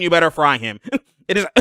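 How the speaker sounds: usual speaking voice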